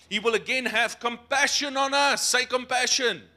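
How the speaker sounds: loud, high preaching shout; rousing proclamation. Real, not acted. Preacher reading prophecy and calling for a response, loud proclamation. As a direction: ring it out and rally the congregation.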